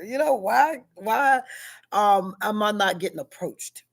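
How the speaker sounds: ordinary talk, nothing marked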